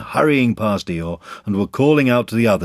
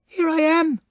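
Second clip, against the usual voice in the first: very high squeaky little voice; squeak; slower than usual. A narrator's reading. Roo calls out to the others, squeaking. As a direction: squeak it like a tiny baby kangaroo. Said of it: "squeaked Roo".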